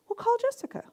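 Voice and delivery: high, soft, unbothered voice; lightly lilting retold answer; calm reply. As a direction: imitate a sister staying calm. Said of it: "She was so calm".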